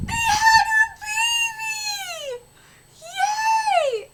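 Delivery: very high, drawn-out cheer; delighted squeal. Acted. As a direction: squeal with joy over a baby. Delight at seeing the baby.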